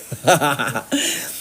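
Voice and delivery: a brief real chuckle; quick laugh to himself; short laugh. Real, not acted. Laughs after closing the lesson. (tawa singkat)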